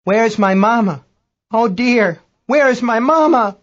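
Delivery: high wail, rising and slowing; distraught crew member. A narrator's reading. Chinese crew member cries for mama, high distraught wail. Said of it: "Chinese voice".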